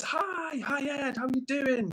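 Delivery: high-pitched, sing-out hello; excited greeting. Retold or imitated. Streamer reading out a returning viewer's hello, excited and high.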